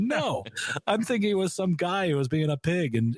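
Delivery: high-pitched mock protest; retold protest. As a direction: protest your innocence, starting high. Retold protest of his misunderstanding.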